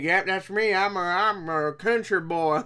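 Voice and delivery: slow, exaggerated country-boy drawl; mock country accent. Reviewer ridiculing the actor's accent, hammed-up country boy. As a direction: ham up a bad Southern country accent. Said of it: "country fuck bullshit accent that he's trying to do".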